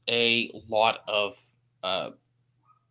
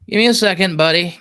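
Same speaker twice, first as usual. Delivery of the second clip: loud and quick, holding a friend off for a second; hurried call to buddy; 4 semitones higher, 5 dB louder than usual. Real, not acted. Streamer loudly asks his buddy to wait, hurried. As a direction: call it out like you're juggling tasks.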